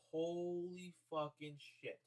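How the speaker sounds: slow, soft disbelieving mutter; stunned mutter. Retold or imitated. Staring at his smashed new car, slow stunned mutter. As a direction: let it drain out of you in shock. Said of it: "So I'm just like".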